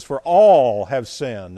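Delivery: "all" loud and drawn out; stress on ALL. Acted. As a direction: lean hard and slowly on "all". Stresses all, not just some.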